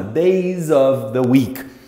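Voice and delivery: slow, careful English model; slow model read. Shown as a demonstration. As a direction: say it slowly for students. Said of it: "dias da semana". (pronúncia lenta e didática)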